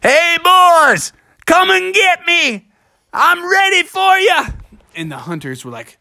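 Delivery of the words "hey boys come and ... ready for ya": high-pitched bold bear calling out; storybook bear's challenge; Bubby taunting. A narrator's reading. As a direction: dare the hunters to come, way up high. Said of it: "he said".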